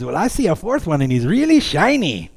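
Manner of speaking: puzzled cartoon gourd with a [name] accent. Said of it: "he's got a [name] accent with a gold tooth".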